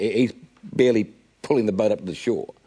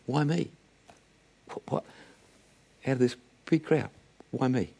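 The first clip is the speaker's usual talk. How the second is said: soft, halting disbelief; quietly stunned, with long gaps between phrases; bewildered man; 8 dB quieter than usual. Acted. The one healed man out of a big crowd, hushed bewilderment. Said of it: "left one man, one man saying".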